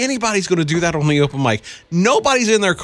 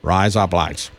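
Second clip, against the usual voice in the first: much lower Australian accent; 8 semitones lower, slower than usual. Shown as a demonstration. Co-host echoing the trick, low Australian accent. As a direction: drop low and say it Aussie. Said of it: "your best Australian accent".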